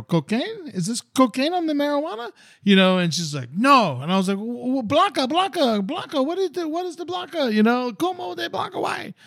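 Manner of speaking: high, strained pleading in pidgin Spanish; hammed-up gringo trying to make himself understood; squeaky, frantic mock question to the old lady; desperate tourist broken Spanish. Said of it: "just doing anything I can to communicate".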